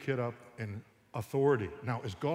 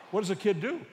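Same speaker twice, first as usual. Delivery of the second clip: usual speaking voice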